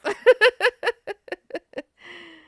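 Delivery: a big high laugh bursts out; sudden amused cackle; loud burst of laughter. Real, not acted. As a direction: let a hearty laugh break loose. Continued laughter.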